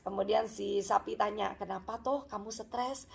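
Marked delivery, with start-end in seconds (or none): 1.6-3.1 hushed friendly question; soft concern. Acted. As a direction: ask it quietly, much softer. Cow gently questions stressed pig.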